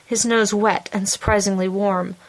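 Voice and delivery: plain reading voice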